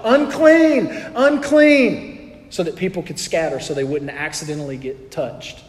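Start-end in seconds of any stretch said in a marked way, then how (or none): 0.0-2.5 high, loud warning cry, drawn out; outcast's shout. Retold or imitated. Leper warning the crowd from fifty paces, loud shout. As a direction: call out to the crowd to scatter, loudly. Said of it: "they would have to shout".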